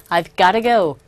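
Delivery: "gotta go" run together quickly, t flapped to gar; casual reduced "gotta". Shown as a demonstration. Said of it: "조금 빨리 말하는 경우에는".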